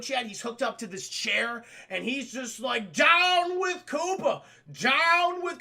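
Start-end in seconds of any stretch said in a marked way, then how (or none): 2.9-5.6 loud, high, stretched-out wooden chant; badly acted rallying cry, dragged out; stilted protest shout. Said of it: "it's just so stilted".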